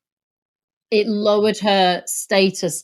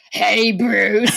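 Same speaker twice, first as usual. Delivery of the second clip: hammed-up drawn-out catchphrase voice; Mark's impression mimicked; 2 semitones lower than usual. Showing how Mark does it, in a mocking character voice. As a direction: send up a clumsy villain impression. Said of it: "Mark's impression is".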